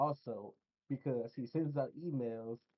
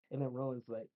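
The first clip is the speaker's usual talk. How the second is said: usual speaking voice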